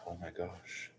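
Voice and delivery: very soft, barely voiced exclamation; hushed suspense. Real, not acted. Crafter making the first staple, hushed with suspense. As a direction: hold it back, almost to yourself.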